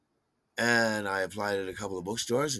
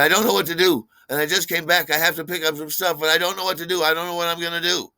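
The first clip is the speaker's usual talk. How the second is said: high, loud, rushed panic; mock frantic; 8 semitones higher, 7 dB louder, faster than usual. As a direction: imitate a friend losing it, pitched way up. Acting out friend's frantic panic.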